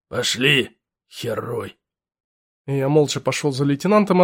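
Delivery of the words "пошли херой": gruff lieutenant. Acted.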